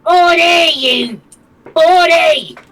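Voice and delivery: very high, loud yelling; shouting. Acted. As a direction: scream his name again and again. Calling out Morty.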